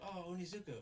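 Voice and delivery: high-pitched acted gasp of a name; mock alarm. Fuyutsuki sees Onizuka arrive, mock alarm. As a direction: imitate a worried onlooker in a high voice.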